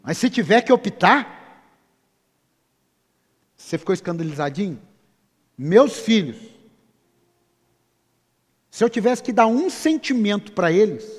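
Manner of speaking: loud, high, urgent preaching; preaching at full force. Acted. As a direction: press the point on the congregation. (pregação inflamada)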